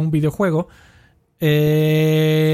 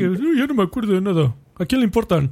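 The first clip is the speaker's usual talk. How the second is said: mocking forgetful guy; 5 semitones higher than usual. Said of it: "aquel güey que".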